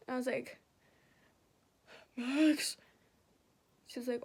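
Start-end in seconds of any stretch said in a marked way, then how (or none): 2.2-2.8 strained answer, imitating agony; acted pained reply. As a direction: squeeze the word out through pain. Gives name while in pain.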